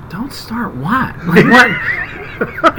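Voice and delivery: puzzled. Retold or imitated. His own baffled reaction retold.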